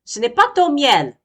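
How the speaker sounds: very high, loud bee voice; a tiny bee shouting the bear away; shrill buzzy bee shout; angry little bee. Acted. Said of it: "dit l'abeille".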